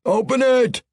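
a high, loud battle roar. Acted. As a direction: roar the order. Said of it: "he roared".

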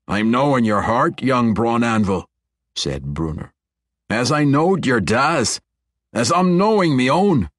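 a hint of a gruff dwarf king, earnest; dwarven brogue. Dwarf Bruner speaks to Conrad.

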